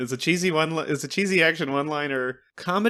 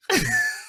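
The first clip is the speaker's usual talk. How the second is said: squealing laugh, much higher; high squeal of laughter. Real, not acted.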